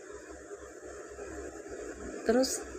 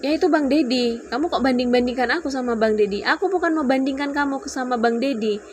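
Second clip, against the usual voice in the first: higher, louder, insistent protest; retold quarrel; 6 semitones higher, 4 dB louder than usual. Couple arguing over comparison with a friend, heated retold quarrel. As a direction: argue back heatedly, as in retelling a row.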